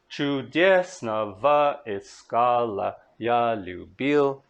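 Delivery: slow, measured reading that leans on each stressed beat; slow Russian verse recital; slow poetry recitation. Shown as a demonstration. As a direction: read the verse slowly, marking the rhythm. Scanning the verse line.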